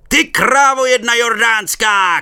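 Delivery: slow loud high insult yelled; fireman shouting. A narrator's reading. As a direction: roar the insult, drawn out. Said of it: "křičel hasič".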